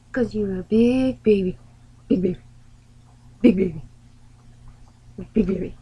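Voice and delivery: loud, playful mocking of a pet; teasing pet talk. Real, not acted. Owner to her whiny dog, loud teasing. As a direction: tease the dog loudly.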